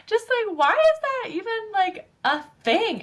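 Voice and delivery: high, loud indignant why; a high-pitched indignant why is that even a thing; indignant high question. Real, not acted. Indignant question mid-rant.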